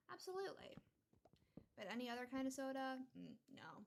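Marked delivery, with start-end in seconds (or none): 0.1-0.8 Absolutely said much higher with rising enthusiasm; repeating Absolutely with delight; emphatic absolutely. Shown as a demonstration. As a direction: gush over Absolutely like a fan.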